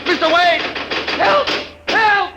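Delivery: loud, high, frightened shouts; desperate calling out; panicked cry for help. Acted. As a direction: yell for rescue in terror. Trapped, cries for help.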